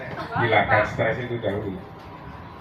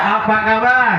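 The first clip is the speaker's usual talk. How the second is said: much higher shouted, slow call; loud cheer call; 9 semitones higher, 4 dB louder, slower than usual. Shown as a demonstration. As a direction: shout it to fire them up. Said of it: "lagi, lagi ya".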